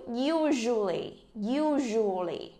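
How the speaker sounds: "usually" slow, stress on the first U. Shown as a demonstration. Teacher models "usually" slowly, stressing the U. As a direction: stretch "usually", leaning on U. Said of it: "Nhấn chỗ u".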